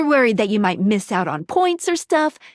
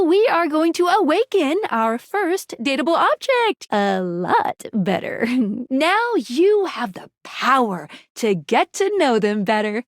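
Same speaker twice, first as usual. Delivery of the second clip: ordinary delivery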